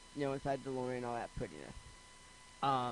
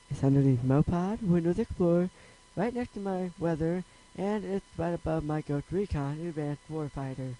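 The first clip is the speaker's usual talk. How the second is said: loud; 10 dB louder than usual. Real, not acted. Suddenly much louder reading icons.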